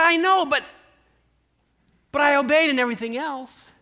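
a son insisting he obeyed; pleading son. Acted. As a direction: son pleading his case to his dad.

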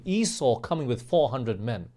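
normal talking voice

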